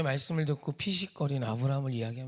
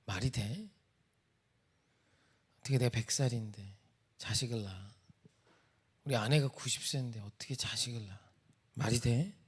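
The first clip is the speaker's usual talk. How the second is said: soft, low incredulous muttering to himself; quietly acted "as if that could happen"; mild scoffing disbelief; 3 semitones lower, 5 dB quieter than usual. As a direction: voice Abraham privately doubting it, understated. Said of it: "피식 웃은 거예요".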